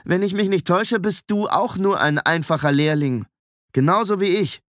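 affronted, pointed reply; defiant retort. A narrator's reading. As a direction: stand your ground against a bully. Indignant newcomer stands up to bully.

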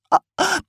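very high gagging heaves; owl retching. A narrator's reading. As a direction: retch up a pellet. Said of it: "das Gewölle hochkam".

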